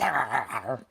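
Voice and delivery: imitated gremlin snarling growl. Owner mimicking the dog when she growls, gremlin growl. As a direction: growl like a riled-up little gremlin. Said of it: "She sounds like a gremlin".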